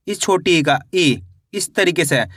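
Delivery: usual speaking voice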